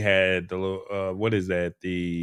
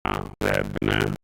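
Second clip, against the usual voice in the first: robotic scrambled audio; garbled like a broken filter; computerized voice; 13 semitones lower, slower than usual. Real, not acted. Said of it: "it's still happening right now".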